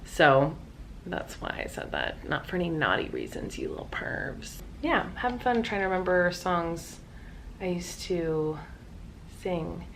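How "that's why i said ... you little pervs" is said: whisper. Real, not acted. Teasing aside to viewers.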